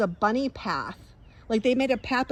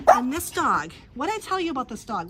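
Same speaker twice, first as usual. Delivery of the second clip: normal talking voice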